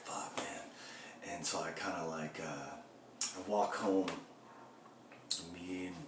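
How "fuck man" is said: soft whispered curse; whispered letdown. Retold or imitated. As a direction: swear under your breath, deflated. Disappointed at the closed store.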